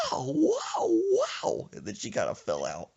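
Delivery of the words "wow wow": grandma swooning. Retold or imitated. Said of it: "and wow".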